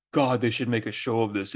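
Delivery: usual speaking voice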